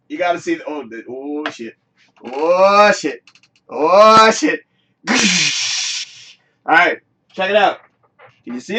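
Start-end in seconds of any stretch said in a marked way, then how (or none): none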